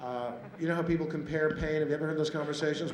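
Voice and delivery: ordinary talk, nothing marked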